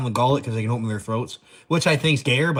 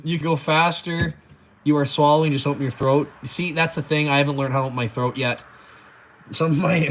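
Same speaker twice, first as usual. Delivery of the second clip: ordinary talk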